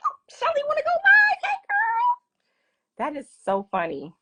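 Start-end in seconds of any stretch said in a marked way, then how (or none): 0.1-2.2 very high, gushy mock girl-talk voice; gushing friend. Mimicking her own delight that Shelly went live, in a high gushy squeal. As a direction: squeal it like an overexcited best friend. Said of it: "I'm like".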